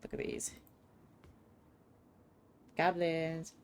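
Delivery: ordinary talk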